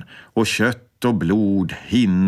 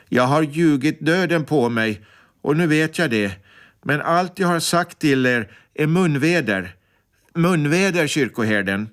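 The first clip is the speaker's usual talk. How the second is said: higher, earnest plea voiced a little up; lightly voiced condemned woman, a touch louder; 7 semitones higher than usual. A narrator's reading. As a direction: say it a bit louder so the priest must hear. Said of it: "så hon säger lite högre".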